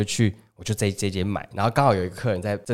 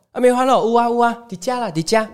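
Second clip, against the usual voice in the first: much higher, eager stall-auntie voice; eager market auntie; 11 semitones higher than usual. Retold or imitated. Market auntie showing the customer where the pork hangs, much higher and eager. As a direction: point out the pork like a keen seller.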